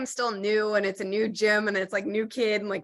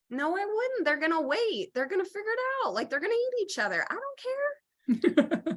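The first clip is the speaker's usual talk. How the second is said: mock defiant retort; 9 semitones higher than usual. To friends who judge her priorities, high mock-defiant voice. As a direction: toss off a carefree refusal. Mock reply to people about cats.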